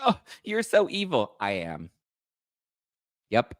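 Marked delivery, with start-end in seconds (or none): 0.1-1.3 mock whiny. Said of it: "she's like".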